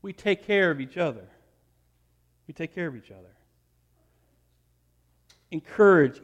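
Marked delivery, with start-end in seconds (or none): 2.5-3.3 gentle murmured repetition; soft repeat. Shown as a demonstration. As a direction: repeat it much softer, falling on "care". Repeated line, second take softer.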